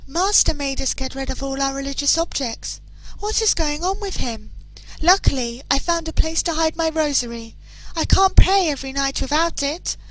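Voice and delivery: a servant confiding to her diary in a lifted voice; servant's diary voice. Acted. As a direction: read it as the frightened maid who hid her rosary.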